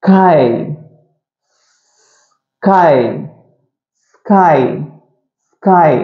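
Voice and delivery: snake-like s, then 'கை'; separate S first. Shown as a demonstration. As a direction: hold the hiss before each 'கை'. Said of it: "first எஸ் மட்டும் தனியா உச்சரிக்கணும்". ('ஸ்ஸ்' தனியாக, பிறகு 'கை')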